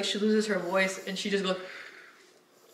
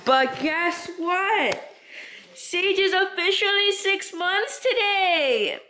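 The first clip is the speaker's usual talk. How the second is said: excited announcement; 10 semitones higher, much slower than usual. Real, not acted. Announcing puppy's half birthday.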